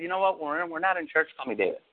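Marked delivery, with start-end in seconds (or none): none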